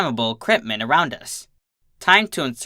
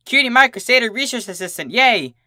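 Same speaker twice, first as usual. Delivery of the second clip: excited cheer; 8 semitones higher than usual. Acted. Excited self-label cheer.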